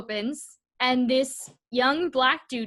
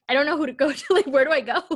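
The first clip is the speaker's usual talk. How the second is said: flustered panic; 4 semitones higher, 6 dB louder, faster than usual. Retold or imitated. Retold panic after being evicted.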